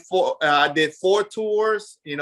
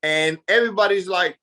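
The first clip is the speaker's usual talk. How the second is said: ordinary delivery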